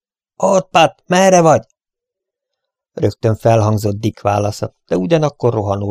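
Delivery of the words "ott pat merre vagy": high, loud call into the dark; calling out to find his friend. Acted. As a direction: shout up the stairs for Pat. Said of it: "hangosan felkiáltott".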